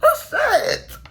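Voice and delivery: high, whiny, half-laughing cry of dismay; hammed-up sob through a laugh; mock wail. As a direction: wail it high like a kid who can't take another hit. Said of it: "I'm like".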